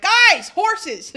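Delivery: high, loud mock-excited shout; excited streamer. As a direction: imitate your own over-excited streamer self. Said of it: "videos of me being like".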